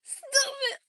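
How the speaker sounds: high, loud squealing stop it; being tickled. Retold or imitated. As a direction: squeal like someone tickling you. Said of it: "I'm that type".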